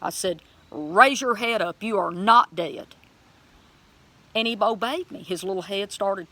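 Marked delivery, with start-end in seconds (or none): 0.7-2.9 loud, firm order to a bird; raised voice, command it to rise. Retold or imitated. Believer commanding an injured bird to rise, commanding. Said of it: "started commanding the bird".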